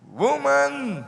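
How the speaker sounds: calling loud and high; a sudden high call of Woman. Acted. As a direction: call her name across the garden. Jesus calls to Mary.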